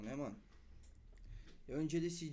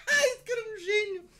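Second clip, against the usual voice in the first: laughing; 13 dB louder than usual. Real, not acted. Cracking up at friend's answer.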